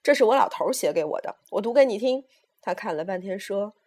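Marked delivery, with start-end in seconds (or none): 0.0-2.2 raised, emphatic voice; old mother declaring loudly; loud announcement. A narrator's reading. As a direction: announce it proudly to the room. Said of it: "大声说".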